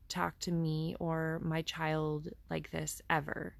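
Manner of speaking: ordinary talk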